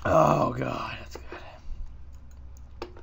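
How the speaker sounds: breathy whispered delight over coffee. Real, not acted. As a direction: whisper it, savoring the taste.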